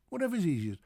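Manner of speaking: normal talking voice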